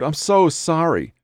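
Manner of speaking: high, tearful apology; sobbing. A narrator's reading. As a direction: plead it through acted sobs. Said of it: "she sobbed".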